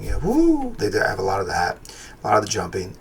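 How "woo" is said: mock cartoon whoop. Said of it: "They have all the noises and everything".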